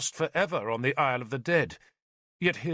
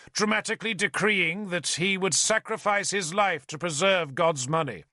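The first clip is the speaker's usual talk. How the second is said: ordinary delivery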